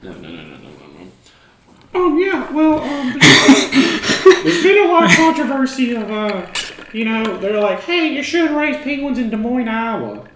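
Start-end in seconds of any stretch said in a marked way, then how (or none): 2.0-10.3 high, loud, put-on penguin dealer voice; sheepish penguin smuggler in a squeaky put-on voice; high character voice. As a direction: play the shady penguin salesman way up high. Back in high Ricky voice.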